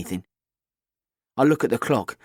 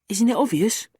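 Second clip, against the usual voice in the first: put-on higher, bright cheeky girl; 10 semitones higher than usual. A narrator's reading. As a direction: play the confident teenage girl. Kat voiced.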